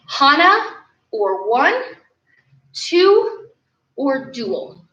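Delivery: usual speaking voice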